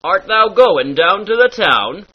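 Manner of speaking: "down" and "town" with a half-Scottish ow; light Scottish ow. Shown as a demonstration. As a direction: bend the ow in "town" toward the Scots. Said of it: "very Scottish sound".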